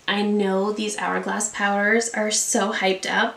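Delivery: usual speaking voice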